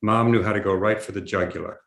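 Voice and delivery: ordinary delivery, nothing marked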